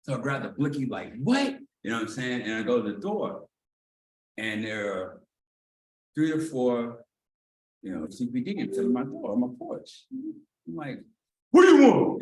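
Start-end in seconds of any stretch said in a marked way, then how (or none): 1.2-1.6 sharp high shouted what; calling out. Retold or imitated. Startled tenant calling toward the door, sharp shout. 11.5-12.2 loud high demand through the door; shouting. Retold or imitated. Man yelling at police on his porch, loud and high.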